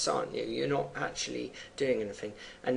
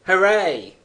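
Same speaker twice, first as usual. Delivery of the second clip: loud mocking cheer, higher; callous cheer; 6 semitones higher, 13 dB louder than usual. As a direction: cheer it out with cruel glee. Doctor's callous cheer in poem.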